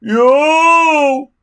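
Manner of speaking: high, loud yelp of pain; yell of pain. A narrator's reading. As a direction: yelp as he sits on the bite. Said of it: "yelled Dog's Breath".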